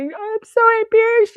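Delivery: high, loud, whiny put-on girl's voice; crying girl. Other woman crying in embarrassment, high loud whine. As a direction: mimic the other woman wailing. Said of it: "The girl's crying down".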